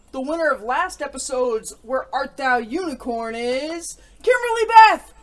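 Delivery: loud, high, slowing to the name; big prize reveal; winner announcement. Real, not acted.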